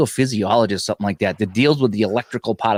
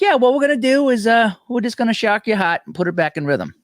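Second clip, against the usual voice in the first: casual doctor voice; 10 semitones higher than usual. Retold or imitated. Doctor voiced in retold story.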